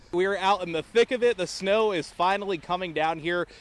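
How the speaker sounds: high-pitched, building to a loud, gleeful shout; excited live report. Real, not acted. Reporter making a snow angel on air, excitedly building to a shout. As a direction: throw to the weather with mounting excitement.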